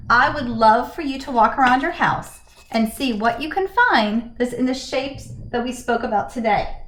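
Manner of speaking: ordinary talk, nothing marked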